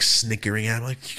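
ordinary delivery

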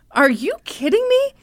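acted-out disbelief, high and loud; indignant retort. Father answering the woman who accuses his son, indignant. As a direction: imitate a father hotly challenging an accuser.

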